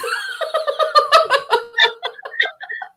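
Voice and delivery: high rising laugh of pure delight; real gleeful laugh. Real, not acted. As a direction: laugh out at the memory. Laughs at her own story.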